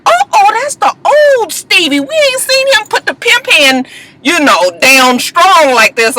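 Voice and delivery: high, excited mock delight; excited teasing. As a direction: squeal it like a thrilled fan. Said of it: "I was like".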